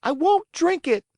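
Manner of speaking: put-on high, loud puppet-boy cry; cried out. Pinocchio crying out at the fairy in refusal. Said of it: "cried Pinocchio".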